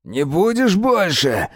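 high, slow rasping threat; hoarse. A narrator's reading. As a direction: rasp it out while pinning him. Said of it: "прохрипел Мирон".

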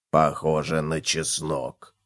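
low, slow, soft guess; pondering cockroach. A narrator's reading. Cockroach identifies the pot's smell, pensive. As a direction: muse slowly in a deep quiet voice.

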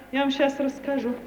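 usual speaking voice

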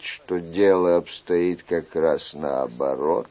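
slow, low, softened royal voice; king, gently reproachful. Acted. King pities the stubborn young man, gently reproachful. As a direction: draw the words out with pitying reproach.

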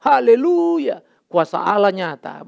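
slow, drawn-out hammed-up hallelujah; preacher mocking a showy faith healer. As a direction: parody the showy preacher's cheer. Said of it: "janji-janji sekarang kan gampang".

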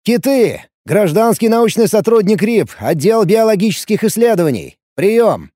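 calling out. Acted. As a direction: call it out clearly over radio. Calling out over radio.

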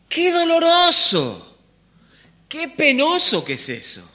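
high, loud exclamation of dismay; pained outcry. Real, not acted. Preacher laments those who never heard the voice, pained outcry. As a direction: cry out the lament from the pulpit.

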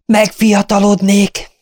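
high, slow, delighted exclaim; enthusiastic cry. A narrator's reading. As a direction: cry it out with rising joy. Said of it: "kiáltotta lelkesen Sir Cecil".

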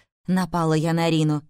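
plain reading voice

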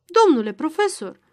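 loud, high indignant address; enraged. A narrator's reading. Magistrate angrily calls the professor to order, enraged. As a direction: pull rank angrily on the professor. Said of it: "se înfurie Solgăbirăul".

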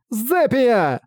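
high, strained cry of a name; anguished moan. Acted. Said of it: "простонала она".

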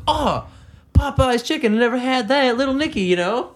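mock wide-eyed guy. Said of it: "I was like".

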